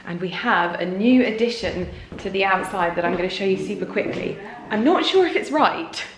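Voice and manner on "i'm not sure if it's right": laughing through the words, high giggle; amused disbelief; laughing at a new fitting. Real, not acted.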